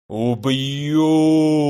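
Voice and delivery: one long, swelling monstrous growl; growling elemental. A narrator's reading. Elder elemental mortally insulted by the mages, long growl. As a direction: drag the threat out like a giant. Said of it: "оглушительно прорычал старший элементаль".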